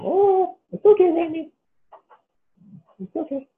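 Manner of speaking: very high sing-song voice for a dog; puppy voice. Real, not acted. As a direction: coo up high like talking to a puppy. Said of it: "This is [name]'s puppy voice".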